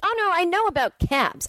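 high, put-on voice of the cab guy; high mocking voice. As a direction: squeak it out like someone else. Acting out a dispatcher's voice.

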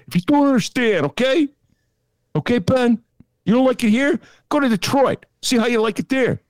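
put-on Chicago accent. Chicago Dem scolding Ben about the rules, Chicago accent. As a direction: ham up a Chicago local lecturing Ben. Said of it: "That's my Chicago accent".

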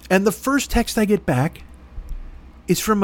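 ordinary talk

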